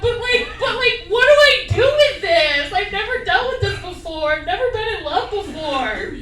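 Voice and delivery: hammed-up lovesick despair; begging friends for advice, high and anxious; mock desperate pleading. Pleading with friends after breakup.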